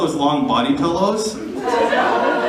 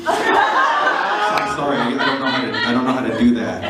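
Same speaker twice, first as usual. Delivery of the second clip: laughing apology. Retold or imitated. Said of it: "And I was like".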